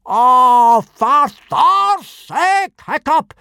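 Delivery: very high, slow, loud howl; explode, stretching every word; exploding in exasperation. A narrator's reading. Teacher at his pupil's silly French, exploding. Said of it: "exploded Gobber".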